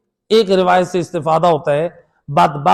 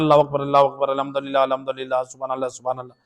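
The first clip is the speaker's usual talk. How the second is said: rushed mumble; 3 semitones lower, 6 dB quieter, faster than usual. Shown as a demonstration. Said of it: "وہ جلدی جلدی پڑھتے ہیں".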